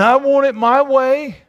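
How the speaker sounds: hammed-up selfish demand; mock selfish demand. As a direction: voice the spoiled ego insisting. Selfish voice voiced.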